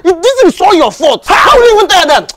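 high, loud and fast scolding; fast, high-pitched telling-off of the friend who caused it all; angry high rant. Acted. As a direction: lash out at her for bad advice. Friend scolds adviser angrily.

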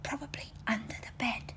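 hushed, secretive whisper. Acted. Princess whispers to dad about ghosts. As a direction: breathe it like a scared child.

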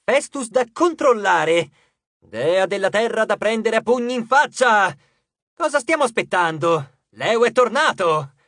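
loud, high-pitched rallying cry; exclaiming. A narrator's reading. Leo rousing the crew to work, exclaiming. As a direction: build a bold, showy battle call. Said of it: "esclamò Leo".

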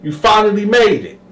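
high eager greeting; Sweet Lo greeting. Retold or imitated. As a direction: lure him in cheerfully. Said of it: "Sweet Lo was like".

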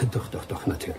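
breathy laugh through the words. Acted. To the departing lady, laughing as he agrees.

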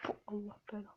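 cursing under his breath; muttered swearing; whispered curse. Real, not acted. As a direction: hiss it quietly in defeat. Cursing after failing.

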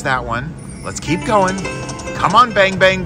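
usual speaking voice

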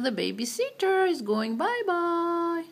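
put-on high, slow sing-song baby talk. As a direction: stretch out bye-bye sweetly.

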